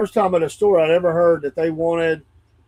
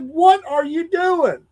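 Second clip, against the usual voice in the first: indignant demand; 11 semitones higher, 3 dB louder, much slower than usual. Retold or imitated. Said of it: "I said".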